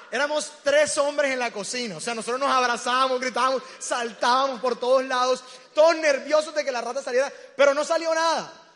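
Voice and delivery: loud frantic storytelling, pitch up; excited retelling. Retold or imitated. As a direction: relive the chaos at full volume. Retelling panic in the kitchen.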